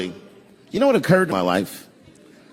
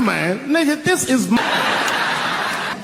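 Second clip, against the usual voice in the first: a hint of a higher, drawn-out laughing voice; imitating himself; 8 semitones higher, much slower than usual. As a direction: laugh it out slowly, half in disbelief. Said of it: "I was fucking".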